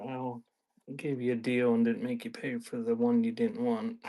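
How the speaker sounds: usual speaking voice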